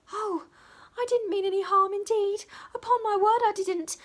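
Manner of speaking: put-on high, loud pleading girl's cry; a scared little maid begging not to be punished; small servant crying out, caught at the keyhole; frightened small servant. A narrator's reading. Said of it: "cried the small servant".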